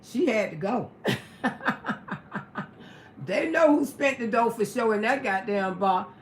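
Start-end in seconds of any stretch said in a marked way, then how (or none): 1.4-2.6 high, airy laugh with no voice; breathy high giggle. Real, not acted. After telling how the woman got thrown out, a high airy laugh. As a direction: laugh silently at the memory. Laughing at her own story.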